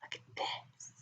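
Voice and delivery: breathy hush; whisper. Acted. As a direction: barely whisper it.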